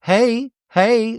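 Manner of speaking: high mock greeting with attitude. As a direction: toss it out with put-on attitude. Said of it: "A little attitude is thrown".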